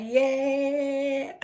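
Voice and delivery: high, drawn-out cheer; mock elation. Cook replaying joy at husband's praise, mock elation. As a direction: sing it out in triumph.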